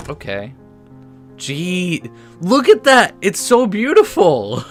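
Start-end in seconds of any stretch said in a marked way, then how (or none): 1.4-4.6 high, loud burst of delight; excited gushing. Real, not acted. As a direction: gush loudly, pitch way up and words stretched. Gushing at the game's scenery.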